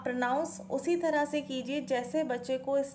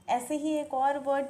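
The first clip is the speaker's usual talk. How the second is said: normal talking voice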